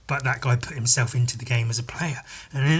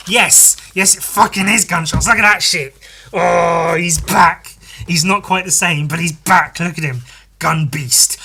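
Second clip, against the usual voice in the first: excited shout; 4 semitones higher, 6 dB louder than usual. Retold or imitated. Excited reveal of hero's return.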